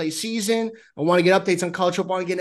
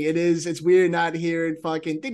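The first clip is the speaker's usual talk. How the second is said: ordinary talk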